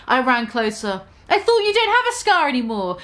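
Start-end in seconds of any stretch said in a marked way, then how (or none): 1.3-3.0 higher mock shout. A narrator's reading. Goth girl rushing to Harry, higher mock shout. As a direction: call out to him, alarmed. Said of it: "I shouted".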